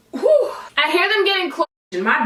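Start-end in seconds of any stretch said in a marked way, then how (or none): 0.1-0.5 loud high whew. Real, not acted. Reaction to taste.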